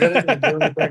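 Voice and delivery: a laughing mock protest of innocence. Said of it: "I was like".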